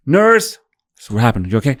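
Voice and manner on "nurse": high, loud call for the nurse; patient shouting for the nurse, high and loud. Retold or imitated. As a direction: yell across the ward. Calling out for the nurse.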